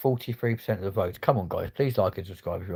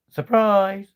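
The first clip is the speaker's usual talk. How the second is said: high, loud "gotcha" cry; spring it on them like a prank; acted surprise reveal; 11 semitones higher, 8 dB louder than usual. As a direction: pretend jump-out shout, pitched up. Imagines popping up in prank.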